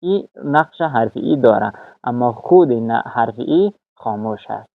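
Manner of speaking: ordinary talk, nothing marked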